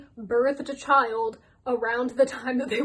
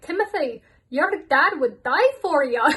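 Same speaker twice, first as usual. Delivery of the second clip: higher, louder hammed-up Scottish stepmom; mock Scottish accent; 4 semitones higher, 6 dB louder than usual. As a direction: do a Scottish stepmom consoling her stepson, over the top. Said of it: "My apologies to the whole of Scotland".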